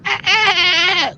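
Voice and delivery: high squeaky dolphin chirps; dolphin squeaks. Acted. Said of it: "squeaks out".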